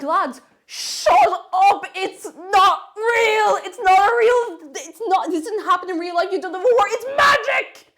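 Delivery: half-laughing, begging the lads to stop; exasperated outburst. Retold or imitated. Retelling yelling at engineering students during Ponyo, loud exasperated plea. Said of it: "I was like".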